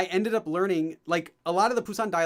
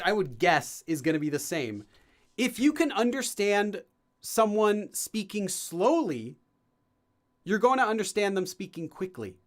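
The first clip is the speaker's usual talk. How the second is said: ordinary talk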